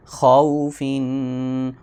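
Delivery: خوفن drawn out slowly; slow spelling demo; slow joined reading. Shown as a demonstration. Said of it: "فا دو زیر فن،".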